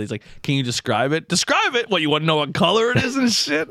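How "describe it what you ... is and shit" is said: high-pitched, incredulous retort; mock-indignant echo. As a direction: throw the question back, voice way up. Caller indignantly echoes the question.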